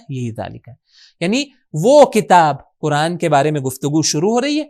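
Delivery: ordinary talk, nothing marked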